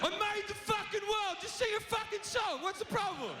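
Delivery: God losing patience; high indignant deity squawk; angry God. Acted. God angrily demanding songs.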